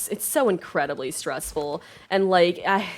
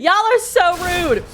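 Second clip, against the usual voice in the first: high, loud, slow outraged yell; indignant yell; 10 semitones higher, 6 dB louder, slower than usual. Real, not acted. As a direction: scold the wolves at the top of your voice. Yelling at enemies in game.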